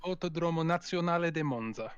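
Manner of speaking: rolling Italian pronunciation of Autodromo Nazionale de Monza; full Italian accent. Acted. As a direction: say it like a proud Italian commentator. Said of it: "Your accent game is on point".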